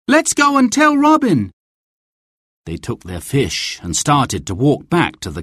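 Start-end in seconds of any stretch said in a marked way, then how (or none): 0.1-1.5 high, urgent call to a companion; urgent cry. A narrator's reading. Will rallies his friend to tell Robin, crying out urgently. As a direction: pitch it up and urge him on. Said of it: "Will cried".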